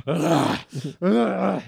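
growing groans of road rage; Sebastian Maniscalco raging. Retold or imitated. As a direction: groan as if about to explode. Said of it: "starting to rage out".

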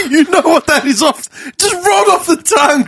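high laughing sarcasm; laughing tease. Real, not acted.

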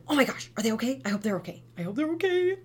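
high gasping worried cry; mock panic. Mom reacts to child's fall, high mock panic. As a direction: fret like a frightened mom. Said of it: "To, like, gasp and".

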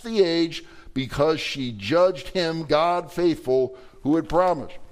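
scripture reading. Acted. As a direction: read it out to the congregation like holy writ. Reads scripture verse aloud.

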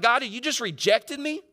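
high, pleading protest; acted cry of rejection; shouting at God. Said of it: "You ever shout that at God?".

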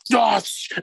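high, loud celebratory yell; retold cheer. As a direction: imitate a fan's excited shout. Retells West Ham end celebrating.

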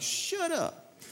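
high, breathy-soft incredulous shut up; mock disbelief. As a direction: squeal it in disbelief. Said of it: "I'm like".

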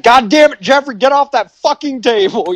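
mock yelling dad, pitched well up; a hint of a fed-up father; hammed-up angry father scolding. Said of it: "and his dad".

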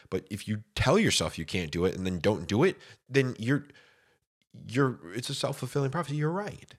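ordinary talk

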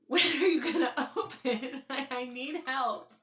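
desperate slow begging; hungover plea. Retold or imitated. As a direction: beg for help through a laugh. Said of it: "And I'm like".

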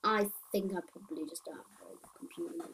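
ordinary talk

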